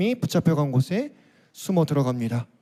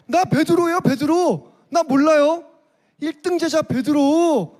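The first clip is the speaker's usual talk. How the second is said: higher, excited protest, chest puffed out; boastful Peter; 6 semitones higher than usual. Acted. Peter swearing loyalty to Jesus, proud and high-pitched protest. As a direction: insist you'd never betray him. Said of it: "베드로 어떻게 말했을까요".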